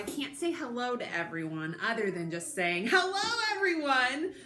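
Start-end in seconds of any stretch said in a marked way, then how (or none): 2.9-4.3 excited greeting. Shown as a demonstration. Greeting all the children at recorded story time, excited. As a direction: sing out a big high hello.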